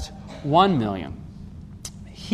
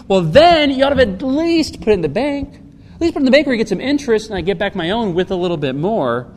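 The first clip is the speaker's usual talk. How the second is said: louder, higher scolding that eases off; exasperated reproach; 6 semitones higher, 4 dB louder than usual. Acted. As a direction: tell off a servant who should have known better. Master scolds the servant, acted.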